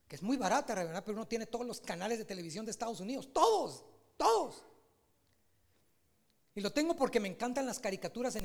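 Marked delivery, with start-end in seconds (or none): 3.3-4.7 higher and louder, punching each word; excited emphasis. Real, not acted. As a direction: pastor boasting of the app's channels, excited.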